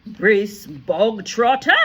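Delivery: loud, slow barking headmistress. A narrator's reading. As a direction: bark the name out to the whole school. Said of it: "the Trunchbull barked suddenly".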